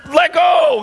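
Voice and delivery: high, loud alarmed shout; yelling let go. Retold or imitated. Parents yelling at their baby to let go of her hair, high loud shout. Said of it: "we're looking at her going".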